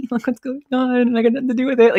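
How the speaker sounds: high, quick witchy voice, hammed up; cackling little witch, feigning innocence. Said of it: "I feel like a little witch".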